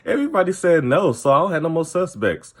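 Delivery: high, naive mimicry; high-pitched, simple-minded imitation; mock dinky. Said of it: "She's so fucking dinky".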